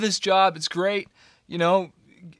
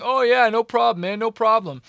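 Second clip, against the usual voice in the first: higher and louder, easygoing no problem; breezy reassurance; 6 semitones higher, 8 dB louder than usual. Retold or imitated. Manager promising to cover his bills, breezy higher-pitched reassurance. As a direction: toss it off like it's nothing. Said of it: "I remember him saying".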